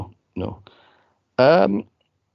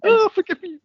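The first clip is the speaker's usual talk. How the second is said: high loud hammed-up plea; high mock-desperate nun voice; mock pleading nun; 5 dB louder than usual. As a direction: beg forgiveness in a squeaky falsetto. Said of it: "She's going".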